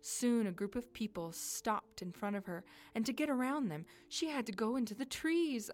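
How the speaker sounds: ordinary delivery, nothing marked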